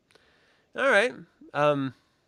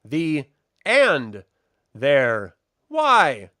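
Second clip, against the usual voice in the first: halting reader; 10 dB louder, much slower than usual. Retold or imitated. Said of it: "reads it as if she does not know how to speak English".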